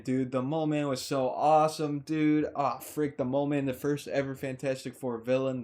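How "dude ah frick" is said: normal talking voice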